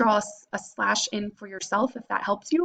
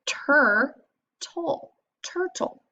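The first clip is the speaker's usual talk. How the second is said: tur, toll, turtle climbing higher; sing-song sounding out; 5 semitones higher, much slower than usual. Shown as a demonstration. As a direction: lift each part up into turtle. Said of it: "and that says er".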